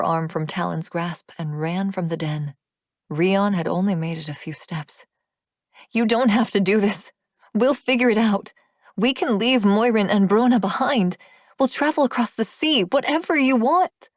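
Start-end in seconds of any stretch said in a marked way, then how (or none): 5.9-14.1 louder, higher, rising desperation; desperate plea. Acted. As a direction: beg him not to leave. Ariana pleads with him.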